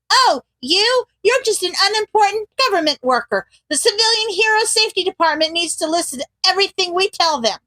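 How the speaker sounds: high, fast sneering mimicry of bosses; a mocking boss voice; mocking impression. Mocking the commission's snooty words.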